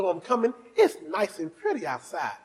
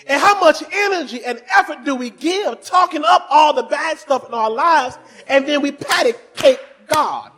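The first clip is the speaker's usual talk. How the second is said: preaching shout; 7 semitones higher, 7 dB louder than usual. Real, not acted.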